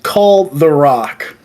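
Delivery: slow phone order. Retold or imitated. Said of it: "and he goes".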